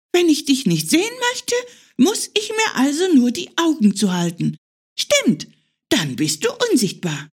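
put-on high, quick childlike bunny voice; a small hare proud of his discovery; little hare figuring out. Hare works out invisibility, high and eager.

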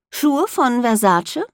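slow, teasing man's voice; mocking male voice. A narrator's reading. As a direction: tease her slowly. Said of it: "sagt Luke".

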